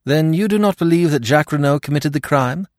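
eager question. A narrator's reading. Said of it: "I asked eagerly".

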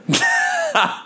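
shrill burst of laughter; high squeal laugh. Real, not acted. Reacts to werewolf doctor ending.

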